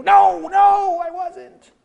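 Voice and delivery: high, loud denial; Peter's panicked denial. Retold or imitated. Peter denying to the servant girl, high and loud. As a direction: panic and deny it. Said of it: "Peter denying".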